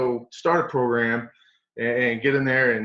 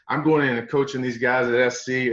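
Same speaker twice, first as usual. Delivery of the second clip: ordinary talk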